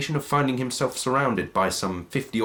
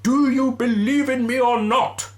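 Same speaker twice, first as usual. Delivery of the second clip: ghostly wail; 6 dB louder than usual. Acted. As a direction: intone it like a phantom. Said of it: "replied the ghost".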